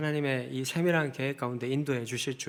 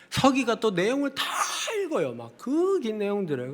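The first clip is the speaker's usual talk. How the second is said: high-pitched, with 다 stretched right out; exasperated exaggeration; 9 semitones higher, 5 dB louder than usual. Real, not acted. Pastor recounting an endless meeting to the congregation, exasperated exaggeration.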